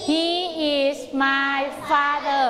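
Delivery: very slow, word-by-word drill answer; slow choral answer. Shown as a demonstration. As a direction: drag each word out for the kids.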